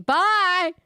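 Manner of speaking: loud, high-pitched shout; shouted goodbye. Acted. Cutting off someone asking for time to explain, shouted goodbye. Voiced character cuts off explanation.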